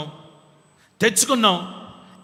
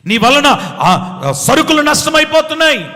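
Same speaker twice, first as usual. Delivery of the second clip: rousing shout; 7 semitones higher, 7 dB louder than usual. Acted. God calling the sleeping prophet to wake, loud and high.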